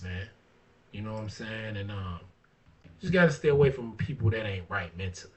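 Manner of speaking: ordinary delivery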